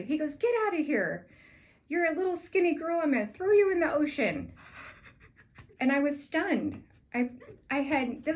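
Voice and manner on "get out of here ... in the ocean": loud, higher, snarling threat; mean old chef. Retold or imitated. Old chef threatening the new rep, mock-angry loud. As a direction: bark it at the skinny new sales girl. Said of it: "He was so mean".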